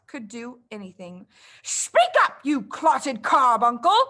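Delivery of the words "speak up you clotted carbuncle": enraged giant headmistress yelling; furious roar. Acted. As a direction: roar the insult at the girl. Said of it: "roared the Trunchbull".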